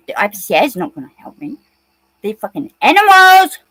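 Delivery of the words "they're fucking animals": angry outburst, a bit louder; heated, louder rant at the police; real anger. Real, not acted. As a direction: let real anger boil over. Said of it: "I'm getting angry".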